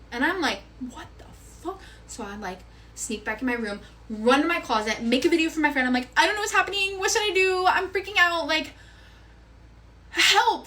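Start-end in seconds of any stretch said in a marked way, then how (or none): 0.8-1.8 very soft, disbelieving aside; hushed retold reaction; stunned under the breath. Secretly sees them touching, stunned reaction. 6.2-10.7 high, urgent retold plea; high-pitched mock panic; panicked. Panicked video message to her friend.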